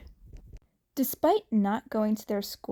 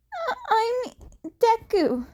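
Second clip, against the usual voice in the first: put-on nervous boy; 11 semitones higher than usual. A narrator's reading. Nervous Izuku introduces himself.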